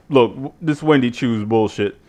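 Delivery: ordinary talk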